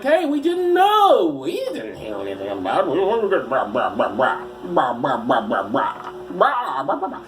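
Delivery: silly put-on police voice, pitch swooping; mock Mountie. Mountie excusing the police's silence, mock blabbering voice. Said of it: "That's how the Royal Canadian Mounted Police talk".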